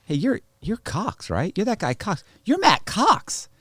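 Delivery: high-pitched stranger recognizing someone; imitated starstruck fan, slow and high; excited fan. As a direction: draw it out high, as if spotting a celebrity. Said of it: "he goes".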